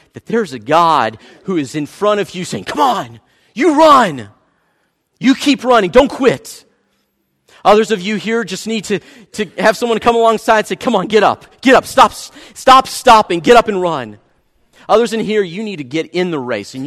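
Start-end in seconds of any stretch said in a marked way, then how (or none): 2.6-4.3 louder, higher rallying cry; urgent encouragement. Acted. God urging the weary runner on, rallying. 5.2-5.9 louder, higher push on "You keep running"; urgent encouragement. Acted. God urging the runner on, loud rally. 5.9-6.7 urgent encouragement. Acted. God urging runners on. 10.8-14.2 rousing push. Acted. Friend alongside a fallen runner, loud rousing push.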